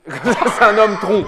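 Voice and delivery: slow, laughing dark joke. Real, not acted. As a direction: chuckle it out slowly. Laughs at his own cruel joke.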